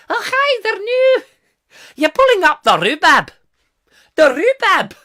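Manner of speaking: very high, loud hammed-up Scottish accent; mock Scottish accent. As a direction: squeal it in silly Scottish. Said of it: "they don't say those sort of things".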